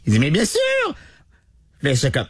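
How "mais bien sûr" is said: very high, exclaimed "bien sûr"; excited biker. Retold or imitated. Driver insists, voice leaping very high. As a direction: squeal it out with delight.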